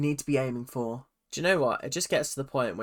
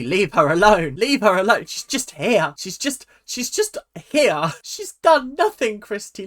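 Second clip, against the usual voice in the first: loud, heated yelling at the screen, rising higher by the end; real shout; 6 semitones higher, 7 dB louder than usual. Real, not acted. A viewer yelling at Christy on screen to leave Shelley alone, real loud shout. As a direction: shout to defend her, getting higher and louder.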